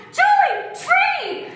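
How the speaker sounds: high, loud, slow shout of alarm; panicked warning shout. Retold or imitated. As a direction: yell a warning to the driver. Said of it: "my mom's like".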